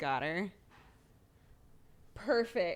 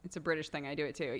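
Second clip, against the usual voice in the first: ordinary talk, nothing marked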